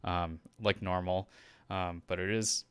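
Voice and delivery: breathless after a run; out of breath. Real, not acted. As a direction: still panting from running. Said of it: "I'm still kinda catching my breath".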